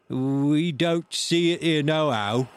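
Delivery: slow, high, loud sailor's voice; pirate calling back. A narrator's reading. Man at window to blind beggar, loud call. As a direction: holler the answer down, drawn out.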